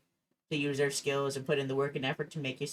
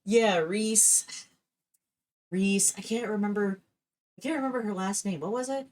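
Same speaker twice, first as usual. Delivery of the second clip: higher, a bit fast, mock searching; faking forgetfulness; 6 semitones higher than usual. Setting up the joke for mom, mock forgetful. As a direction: play dumb about her name. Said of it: "I was like".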